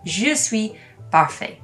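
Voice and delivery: Je suis parfait in French, final T silent; French pronunciation. Shown as a demonstration. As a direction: masculine form, Je suis parfait, French style. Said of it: "Or if I was a guy".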